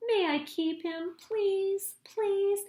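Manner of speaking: high, pleading little voice; begging child. A narrator's reading. Little Grunt begging the chief to keep George, pleading. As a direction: beg sweetly for the pet.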